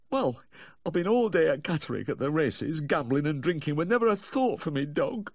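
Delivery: high, anguished Yorkshire owner; distraught confession. A narrator's reading. Dog owner confessing a day at the races, distraught. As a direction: pour out the guilt in a high voice.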